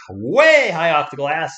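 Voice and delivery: loud, slow, drawn-out call; loud, slow play-by-play cry; excited call. Real, not acted. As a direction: stretch it out loud like a missed shot.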